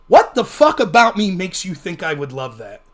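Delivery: loud, incredulous retort, acted out; indignant outburst. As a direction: challenge him in mock outrage. Retold outburst at the hippie.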